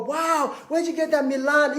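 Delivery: gushing owner. Retold or imitated. Owner gushing at opening party.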